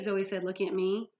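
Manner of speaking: plain reading voice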